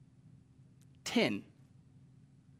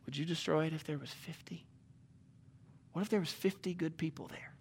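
hushed pleading; 2 semitones lower, 12 dB quieter than usual. Acted. Abraham bargaining quietly with God.

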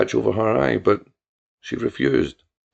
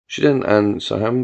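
usual speaking voice

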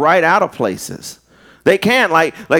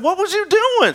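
shocked demand; 14 semitones higher than usual. Retold or imitated. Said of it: "And I said".

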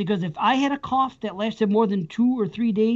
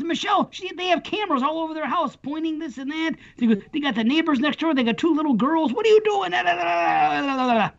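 higher, mock scolding wife voice; imitating wife; 6 semitones higher than usual. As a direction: play the nagging wife, lightly, pitched up. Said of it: "She goes, she goes".

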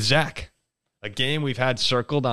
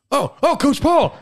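much higher, louder, slow thrilled cry; excited kids; 5 dB louder, slower than usual. Retold or imitated. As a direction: call out like a kid spotting the coach. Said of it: "they're just like".

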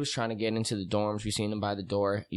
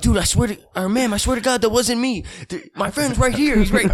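much higher and louder, frantic pleading; pleading panic; 12 semitones higher, 7 dB louder than usual. Retold or imitated. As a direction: beg her to believe you. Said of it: "And he goes".

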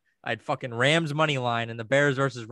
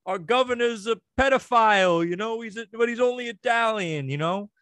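put-on higher-pitched caricature of a New York accent; mock New Yorker; 8 semitones higher than usual. Podcaster mocking New Yorkers he can't stand, caricatured New York accent. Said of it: "they're all fucking".

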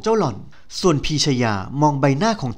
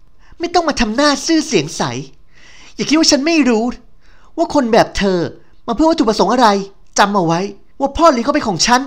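high, loud rival's rant; a spoiled heiress screaming threats at the heroine; jealous tirade; 9 semitones higher, 6 dB louder than usual. Acted. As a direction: warn her off your man, much higher and louder.

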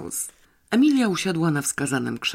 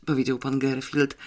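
ordinary delivery

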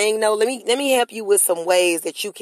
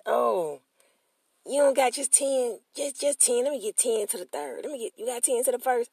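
higher, quieter mock haggling; 4 semitones higher, 6 dB quieter than usual.

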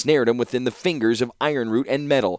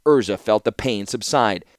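ordinary delivery, nothing marked

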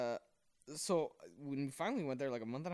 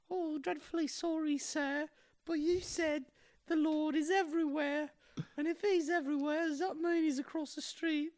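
put-on much higher, prim little boy; 4 dB louder than usual. Little boy questioning the priest in a high prim voice. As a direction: play a polite, innocent little boy. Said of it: "this little boy says".

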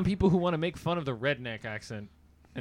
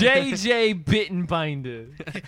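TV host voice; 3 semitones higher, 6 dB louder, much slower than usual. Retold or imitated. Echoing the TV host's name.

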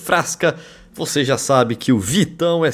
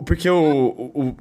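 usual speaking voice

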